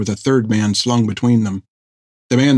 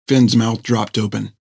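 ordinary delivery

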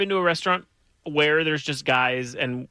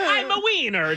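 very high laughing mock Mario; Mario Kart voice; slower than usual. Joking about bad Italian accents, high Mario squeal. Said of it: "like the Mario Kart stuff".

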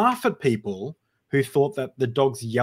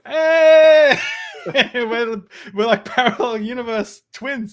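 loud, high laughing whoop; excited shout; 12 semitones higher, 9 dB louder, slower than usual. Real, not acted. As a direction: shout for joy then laugh through it. Excited at shared wife name.

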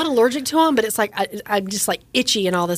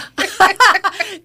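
high, loud burst of laughter; 10 semitones higher, 5 dB louder than usual. Real, not acted. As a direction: laugh hard.

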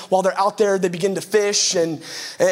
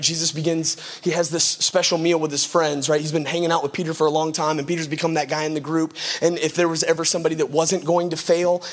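ordinary talk

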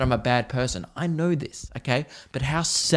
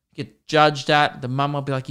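ordinary talk

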